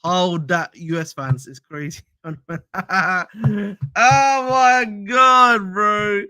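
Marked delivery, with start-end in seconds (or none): 2.2-3.9 real laugh. Real, not acted. Streamer reacting to chat about his accent, laughing. 3.9-6.2 long, loud, high-pitched exclamation; amused outcry. Real, not acted. Streamer reacting to chat's teasing, amused outcry.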